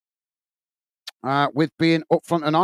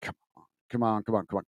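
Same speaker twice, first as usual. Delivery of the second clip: impatient mutter at computer; 8 semitones lower, 10 dB quieter, much faster than usual. Real, not acted. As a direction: hurry the laptop along under your breath, fast and low. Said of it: "I'm talking to the computer".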